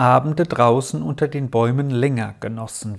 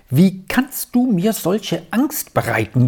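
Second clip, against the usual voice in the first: higher, fretful lament; anxious wail; 6 semitones higher than usual. A narrator's reading. Aunt to her brother, frightened by his late return. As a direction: wring the words out in worry. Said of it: "jammerte sie auf".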